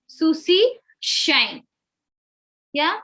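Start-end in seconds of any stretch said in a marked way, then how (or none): 0.0-1.6 slow Susie shine, S and SH kept distinct; crystal clear. Shown as a demonstration. Teacher models clear S and SH for the class. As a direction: say Susie shine slowly, each sound clear. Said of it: "I will read it like this".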